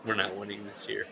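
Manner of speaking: ordinary delivery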